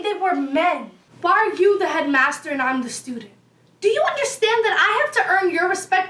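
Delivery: ordinary talk, nothing marked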